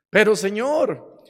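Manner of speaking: alarmed protest. Acted. Hanging man told to let go answers the Lord, in alarmed protest. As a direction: balk at the order, voice jumping up.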